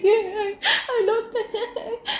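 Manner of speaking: high, slow, laughing through tears; stressed, half crying. Retold or imitated. To kind stranger, high tearful laughing admission. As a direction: choke out the confession with a laugh. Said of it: "I'm stressing".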